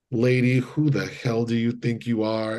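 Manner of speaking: usual speaking voice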